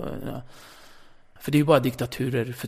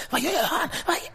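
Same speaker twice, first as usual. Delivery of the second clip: very high frantic mock voices; hammed-up high-pitched alarm; panicked colleagues; 14 semitones higher than usual. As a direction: squeak out the panic backstage. Backstage panic imitated high.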